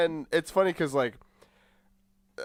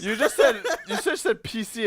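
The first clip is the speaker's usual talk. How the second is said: teasing through laughter; laughing; 7 semitones higher than usual. Real, not acted.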